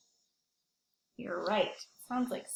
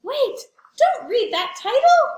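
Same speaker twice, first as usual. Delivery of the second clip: high, loud, panicked pigeon cry; the pigeon begs to stop the reading; frantic pigeon; 9 semitones higher, 9 dB louder than usual. Acted. Pigeon shouts at the teacher not to read the title. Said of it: "He's saying".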